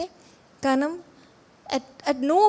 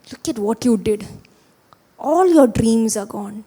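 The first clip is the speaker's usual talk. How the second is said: accusing inner voice; 3 dB louder than usual. Retold or imitated. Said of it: "എൻ്റെ മനസ്സിൽ വരുന്ന ഒച്ചയിൽ".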